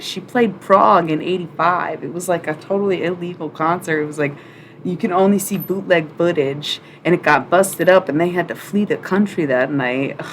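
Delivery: usual speaking voice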